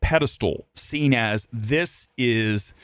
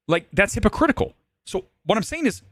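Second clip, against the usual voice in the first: pitched way up, exasperated; worked-up rant; 10 semitones higher than usual. Real, not acted. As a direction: push it up high, fed up. Ranting, pitch jumps high.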